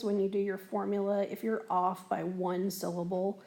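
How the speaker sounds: normal talking voice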